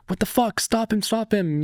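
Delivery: high-pitched mock yelling; imitated cry for help. Robbed man to passers-by, mock-frantic high call.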